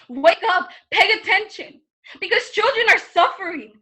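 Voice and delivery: impassioned, forceful appeal; urgent plea. Acted. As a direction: demand that the audience wake up and listen. Performed plea to audience to act.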